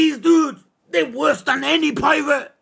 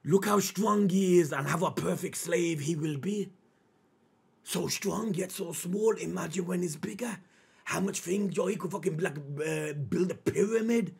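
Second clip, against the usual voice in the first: a hint of a gloating slaver, low and soft; lightly voiced master sizing up a slave, low; mock slaver voice; 6 semitones lower, 9 dB quieter than usual. Reactor mock-translating the slaver's words, lightly voiced low and soft. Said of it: "Shall I translate that for you?".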